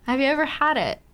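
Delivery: ordinary delivery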